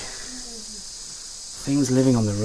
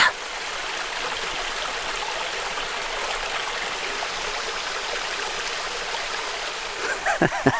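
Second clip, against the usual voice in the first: soft breathy laugh; 3 semitones higher, 9 dB quieter than usual. Real, not acted. Laughing at own tiger joke.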